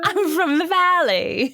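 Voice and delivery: high, slow fake English; terrible English accent. Kid claims to be local, terrible English accent. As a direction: stretch it out in badly faked English. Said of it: "terrible English accent".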